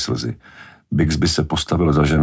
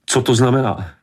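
high-pitched angry question; outraged woman demanding an explanation; shouted demand; 8 semitones higher than usual. A narrator's reading. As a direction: cry out a furious demand in a raised pitch. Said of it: "Křičela".

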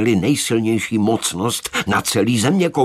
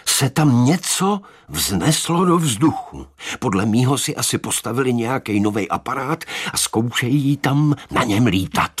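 higher, weighty telling; emphatic; 6 semitones higher than usual. A narrator's reading. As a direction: lean on it to make it matter. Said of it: "aby dodal svým slovům důraz".

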